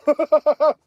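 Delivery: a short high burst of laughter; laughing after showing off biceps. Real, not acted. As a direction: laugh at your own flex.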